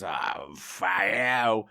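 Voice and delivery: put-on slow, low drunken curse; drunk grumble. Drunk husband cursing as the pub closes, slow and low. Husband swearing at closing time.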